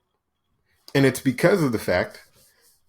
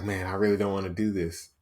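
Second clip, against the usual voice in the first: reluctant mutter; 4 semitones lower, 7 dB quieter, faster than usual. Acted. Whiny inner voice voiced again.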